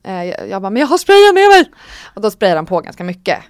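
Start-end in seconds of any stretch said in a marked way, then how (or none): 0.7-1.7 high, loud desperate protest; urgent shouted plea. Retold or imitated. Patient reminds doctor about numbing spray, frantic high shout. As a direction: cry it out as if begging for relief.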